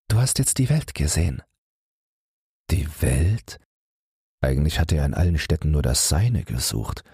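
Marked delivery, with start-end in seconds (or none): 0.1-1.4 lightly voiced wife, higher pitch; wife's letter quoted. A narrator's reading. Wife writing to her husband, lightly voiced and higher. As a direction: read her words out higher. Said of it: "Seine Frau hat ihm neulich geschrieben".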